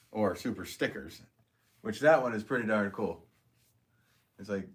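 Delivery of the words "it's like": ordinary talk, nothing marked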